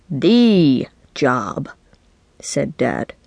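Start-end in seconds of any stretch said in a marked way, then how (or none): 0.1-1.7 "The" said as a long, weighty "thee"; stress on The. A narrator's reading. Dad answers which job, long grand stress on "The". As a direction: stretch "The" out, then drop on "job".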